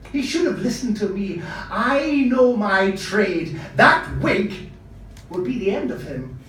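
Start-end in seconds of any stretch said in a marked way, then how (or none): 1.7-4.7 loud, drawn-out boast, then rising; emphatic insistence. Acted. Costumier insists to his nephew on his expertise, emphatic. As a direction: stretch it out with raised, insistent force.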